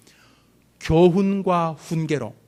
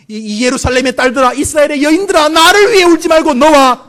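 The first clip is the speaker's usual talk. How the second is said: impassioned calling out; 7 semitones higher, 6 dB louder than usual. Acted. Preacher voicing Jesus to the weeping women, building loud appeal. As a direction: build to a high, loud cry.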